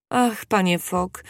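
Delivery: slow, drawn-out, understated cry; a touch of tearful emotion, slowly; tearful exclamation. A narrator's reading. Young woman to Fogg, clutching his hand, understated tearful exclamation. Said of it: "zawołała młoda kobieta, chwytając go za rękę i oblewając ją łzami".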